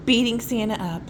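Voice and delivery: usual speaking voice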